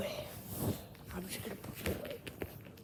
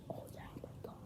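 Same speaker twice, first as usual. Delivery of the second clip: stunned whisper; 4 dB quieter, much faster than usual. Real, not acted. Disbelief at pulled card.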